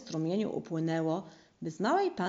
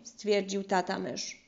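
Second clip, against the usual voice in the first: usual speaking voice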